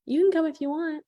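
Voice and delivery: high and loud offhand invite; imitating someone making a half-hearted invitation; mock lukewarm invite. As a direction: toss off the invite like you don't care. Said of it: "It's a whole different look than".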